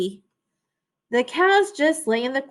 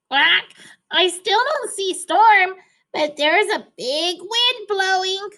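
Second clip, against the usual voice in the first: duck calling down; 8 semitones higher than usual. A narrator's reading. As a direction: shout it down from the window like a duck. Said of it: "Duck called down".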